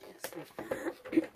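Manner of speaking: hoarse voice giving out to a whisper; losing voice. Real, not acted. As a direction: voice cracking and fading, losing it. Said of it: "kinda losing my voice today".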